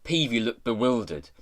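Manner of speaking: plain reading voice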